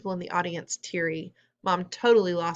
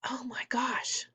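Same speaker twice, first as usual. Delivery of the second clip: whispered, higher gasp of surprise; hushed amazement; 6 semitones higher, 7 dB quieter, slower than usual. A narrator's reading. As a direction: whisper it in shock. Mom's hushed shock during the play.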